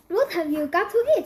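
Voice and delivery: plain reading voice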